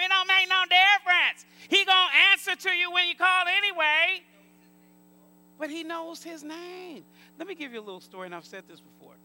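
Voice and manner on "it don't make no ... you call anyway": loud, raised voice of people brushing it off; dismissive objectors. Retold or imitated. Preacher mimics people who dismiss the name, loud mimicry. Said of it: "people tell us all the time".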